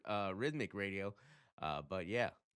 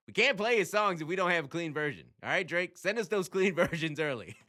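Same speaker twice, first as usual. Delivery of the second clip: loud, raised-pitch plea; exasperated appeal; 6 semitones higher, 8 dB louder than usual. Real, not acted. Radio host pleads with [name] for clean versions, exasperated appeal. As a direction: call out to the artist, half laughing at the end.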